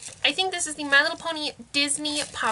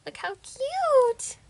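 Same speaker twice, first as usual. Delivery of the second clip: cooing; 9 semitones higher, much slower than usual. Real, not acted. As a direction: gush over something tiny. Cooing over a pony toy.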